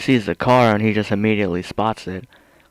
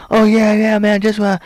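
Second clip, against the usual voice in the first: high, loud eager chatter; mock the suspect's chatty eagerness; mock chit-chat; 10 semitones higher, 5 dB louder than usual. Commentator mocks suspect chatting with cop, high excited voice. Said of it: "he's fucking chit-chatting like".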